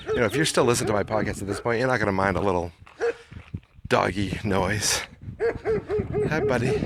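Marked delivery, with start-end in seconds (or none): 6.3-6.8 squeaky high hi to a dog; high dog-greeting voice. Real, not acted. Greets the German Shepherd.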